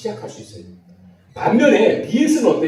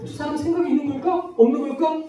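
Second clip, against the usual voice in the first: puzzled inner thought; 7 semitones higher than usual. Retold or imitated. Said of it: "막 이런 생각이 드는 거예요".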